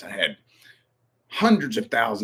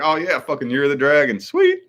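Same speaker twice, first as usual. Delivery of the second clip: loud, high retold excitement, rising to a squeal; excited realization; 6 semitones higher, 6 dB louder than usual. Streamer realizing it's Year of the Dragon, high excited delight. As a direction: re-enact being stoked, climbing very high at the end. Acting out own pleased realization.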